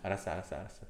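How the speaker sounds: normal talking voice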